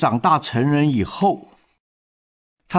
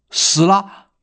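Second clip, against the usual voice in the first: a sudden higher startled question; shocked surprise; 6 semitones higher than usual. A narrator's reading. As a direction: caught off guard by the news. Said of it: "女警吃了一惊". (女警听到噩耗时吃惊地反问)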